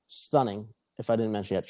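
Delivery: ordinary delivery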